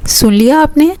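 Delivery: high-pitched, teasing fake indignation; mock anger. A narrator's reading. Brother teasing, complaining to Aman in mock anger. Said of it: "آہل مصنوعی غصے سے امان کو کہنے لگا".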